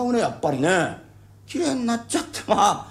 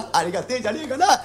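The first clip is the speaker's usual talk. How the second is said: giggling happily, higher; laughing with delight; 6 semitones higher than usual. Real, not acted. Bachelor delighting over his coming bride, laughing happily. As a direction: chuckle through it, thrilled. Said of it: "これ嬉しいね".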